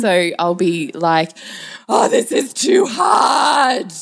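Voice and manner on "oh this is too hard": high, very slow mock wail; hammed-up tantrum. Mother playing up chore resistance for her kids, hammed-up tantrum. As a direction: whine it out high and theatrically. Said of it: "I ham it up".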